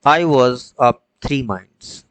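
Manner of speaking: slow, loud, measured reading of the verse; poem recitation. Shown as a demonstration. Teacher reciting the poem's line to students, slow and loud. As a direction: let each word of the line land.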